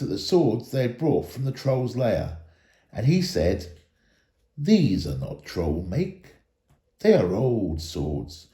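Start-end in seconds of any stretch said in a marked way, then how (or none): 4.6-8.5 low, slow and grave elf lord; a hint of a solemn elder; lightly voiced Elrond. Elrond tells the dwarves of the swords, lightly voiced and grave.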